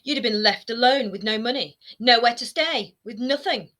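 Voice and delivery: mother nearly shouting at her son; almost shouting. A narrator's reading. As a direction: push to the edge of a shout. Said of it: "She was almost shouting".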